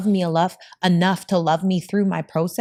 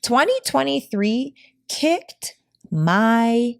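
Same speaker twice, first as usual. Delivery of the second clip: slow, higher, drawn-out exasperation; exasperated; 4 semitones higher, slower than usual. Retold or imitated. Telling her mom about her brutal year, slow exasperated groan. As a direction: drag it out like the year wore you down. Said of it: "I was like".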